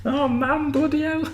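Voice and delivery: very high Yorkshire mum voice; shrill-free high Northern squeal of complaint; Northern accent. Pretend. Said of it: "why are we doing Northern accents".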